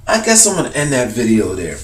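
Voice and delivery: ordinary delivery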